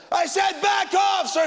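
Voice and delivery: high, slow, barked order; furious shout. Retold or imitated. The cop losing it at Talbot, furious shout. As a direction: blow up at him, stretch each word. Cop loses his temper.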